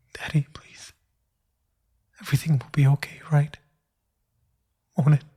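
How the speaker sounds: hushed, breathless pleading; desperate whisper. Acted. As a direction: beg in a frantic whisper. Begging the dead father.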